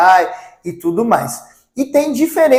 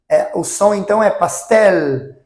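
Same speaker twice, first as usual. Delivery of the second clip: normal talking voice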